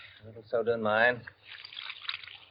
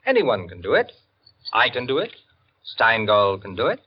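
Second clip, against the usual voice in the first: ordinary talk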